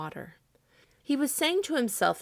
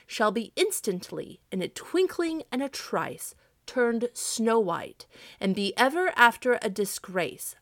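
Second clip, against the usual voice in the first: slow, measured proclamation with pauses; 4 semitones higher, 4 dB louder than usual. A narrator's reading. Santa's written warning to crows, proclaimed. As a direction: read it out like a formal decree, weighting each term.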